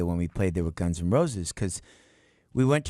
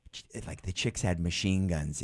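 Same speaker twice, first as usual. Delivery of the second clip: usual speaking voice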